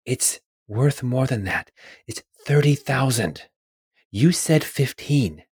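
a hint of a woman's voice, slow and insistent; slow, lightly put-on female character voice. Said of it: "a female voice say".

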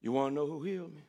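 healed man quietly hinting at Jesus; hushed secret. Acted. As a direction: lean in and tell the secret.

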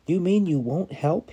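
man shouting down at the stranger; incredulous shout. Acted. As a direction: shout down in disbelief. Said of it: "he shouted to the stranger".

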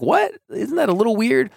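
high, startled mock question; puzzled listener. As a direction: pop way up in mock disbelief. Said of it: "it's sort of like".

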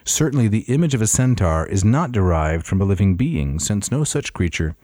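loud, measured reading voice; formal reading. Acted. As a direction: declaim it like an old text. Reads Smith translation aloud.

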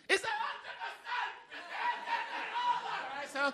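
sudden high laughing squeak; squeaked punchline. Pretend. Comic mocking Daily Mail answer, high laughing squeak. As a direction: squeal the number through a laugh.

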